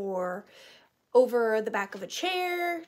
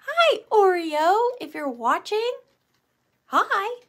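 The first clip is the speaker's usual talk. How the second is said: talking to a dog; 8 semitones higher, 6 dB louder than usual. Real, not acted. Greeting viewer's dog.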